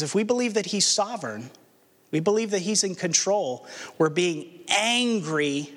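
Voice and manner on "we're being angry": ordinary talk, nothing marked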